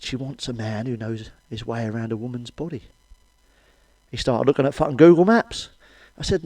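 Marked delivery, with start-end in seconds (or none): 0.0-2.8 softer, hushed retelling; a hint of a whisper; whispered repeat. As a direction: lean in and say it quietly. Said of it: "whispered in my ear".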